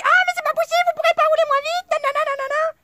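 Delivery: very high, loud mock screech; imitated screaming. Neighbour yelling at a passing driver about speed, imitated screeching. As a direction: shriek it fast, mimicking an angry neighbour. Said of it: "elle me hurle dessus".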